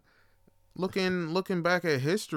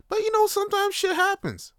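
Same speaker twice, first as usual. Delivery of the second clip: squeaky mock voice. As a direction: jump way higher into a squeaky put-on voice. Mock voice on a punchline.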